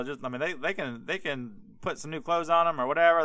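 ordinary talk, nothing marked

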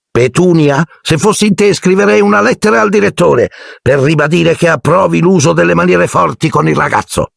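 very high, bossy aunt voice; high domineering aunt; Aunt Marge. A narrator's reading. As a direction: lay down the law, pitched high. Marge advises strict discipline.

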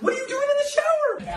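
high, shocked question. Acted. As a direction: recoil in disbelief. Shocked at friend in shower.

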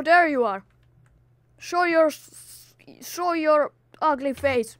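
a hammed-up angry challenge; mock angry shouts. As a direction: call the enemy out. Challenging hidden enemy.

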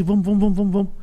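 retold hurried urging, kept light; urgent repetition. Girl urging her boyfriend as they leave, retold with mild urgency. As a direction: rattle the word off, imitating her. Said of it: "a mina falando assim".

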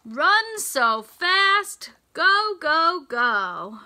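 put-on rally the kids to run; a big read-aloud cheer; excited storybook cheer.

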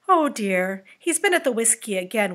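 ordinary delivery, nothing marked

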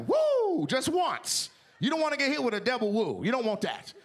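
usual speaking voice

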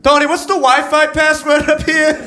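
high, loud call across the room; busy gatekeeper yelling for Tony. Retold or imitated. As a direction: shout over to a coworker. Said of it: "He's like".

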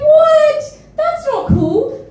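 high, loud, whiny protest; mock outrage. Performer reacting to the haters, high and whining. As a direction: squeal it out, half-wailing. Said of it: "I'm like".

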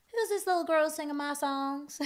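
sweet Tennessee lilt. Retold or imitated. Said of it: "she was like".

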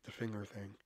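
low, very soft aside; half whisper. Retold or imitated. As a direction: imitate a coworker discreetly murmuring a hint. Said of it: "Andrea kinda whispered".

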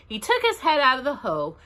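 ordinary delivery

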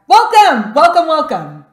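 high, loud triple welcome; excited welcome. Real, not acted. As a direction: cheer the guest in. Host greets the guest.

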